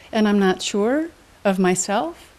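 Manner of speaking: ordinary talk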